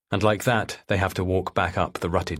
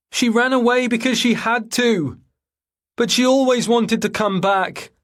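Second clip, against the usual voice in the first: much higher, louder, slower call over the water; shouting across; 14 semitones higher, 4 dB louder than usual. A narrator's reading. Rhys shouting apologies across the river to Paul. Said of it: "shouting across to him".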